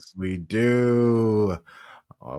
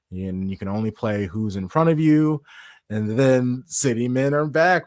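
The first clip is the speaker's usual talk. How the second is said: ordinary talk, nothing marked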